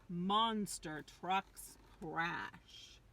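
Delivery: ordinary delivery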